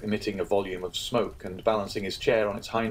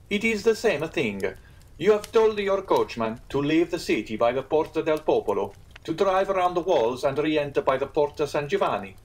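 higher-pitched, insistent innkeeper; earnest Italian innkeeper; 7 semitones higher, 4 dB louder than usual. Acted. Innkeeper reciting a guest's route back to him, insistent. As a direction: voice lifted, laying out each detail.

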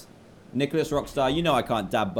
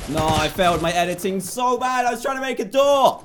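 louder and higher, exasperated at his own mistake; frustrated; 10 semitones higher, 8 dB louder than usual. Real, not acted. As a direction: whine about the botched build. Frustrated at failed edit.

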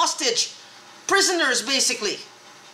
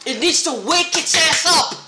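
raised, heated demand; angry outburst; 3 semitones higher, 4 dB louder than usual. Real, not acted. Ranting at the government to wake up, raised and heated. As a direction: push it out harder, fed up.

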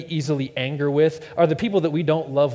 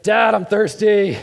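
put-on high, slow, loud little boy whine; slow loud kid whine; whiny kid; 5 semitones higher, 7 dB louder, slower than usual. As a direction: drag it out like a sleepy child. Son voiced.

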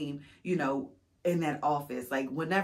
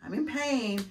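sarcastic self; 9 semitones higher, slower than usual. Retold or imitated. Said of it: "And I'm like".

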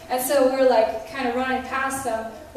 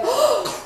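sharp, high startled gasp; mock shocked gasp; imitated gasp; 11 semitones higher than usual. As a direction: suck in air as if suddenly touched. Retold sudden shock.

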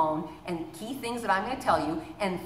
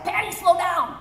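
mock exasperated; 11 semitones higher, 6 dB louder than usual. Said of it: "Everybody's like".

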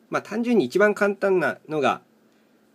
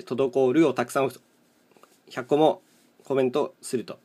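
usual speaking voice

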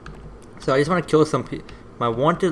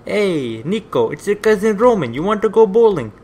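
higher, louder, hammed-up Eastern European cousin voice; Roman's Russian accent; 7 semitones higher, 7 dB louder than usual. Gamer mimics cousin Roman calling Niko to go bowling, hammed-up accent. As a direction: ham up a bad Russian-style cousin inviting you bowling. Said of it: "who was the guy from Grand Theft Auto four".

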